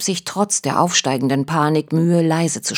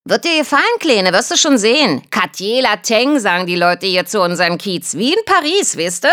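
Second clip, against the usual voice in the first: chirpy chatter; 6 semitones higher than usual. Acted. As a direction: chirp away brightly like a songbird. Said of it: "zwitscherte die Blonde munter weiter".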